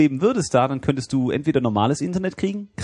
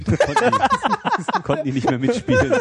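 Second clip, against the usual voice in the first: high-pitched laugh through the words; giggling while finishing the joke. Real, not acted.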